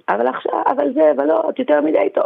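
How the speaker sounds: put-on high, complaining child's protest; imitating a whiny child. Mother imitating her jealous daughter's high complaint. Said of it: "היא התחילה לצעוק לי".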